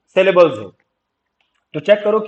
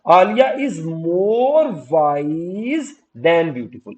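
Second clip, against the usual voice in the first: more wise drawn out very slowly; slow, weighty stress on "more wise"; model sentence; much slower than usual. Shown as a demonstration. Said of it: "तो मैं क्या कहूंगा".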